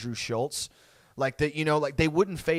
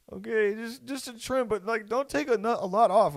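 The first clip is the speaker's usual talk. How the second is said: put-on higher, mildly pleading kid voice; reluctant kid; 7 semitones higher than usual. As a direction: give in nervously, bargaining like a boy. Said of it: "I sucked it up".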